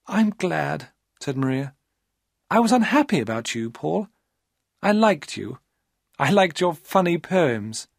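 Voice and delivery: put-on young woman charming Paul; high, wheedling female voice; Maria's coaxing voice.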